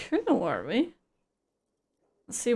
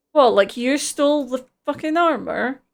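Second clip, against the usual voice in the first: angry accusation; 3 semitones higher, 7 dB louder than usual. Acted. Accusing Gary angrily.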